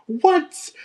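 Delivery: a shocked, disbelieving cry; high-pitched incredulous what; stunned what. Retold or imitated. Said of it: "And I'm like".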